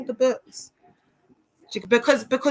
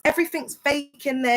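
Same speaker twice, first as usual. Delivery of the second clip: usual speaking voice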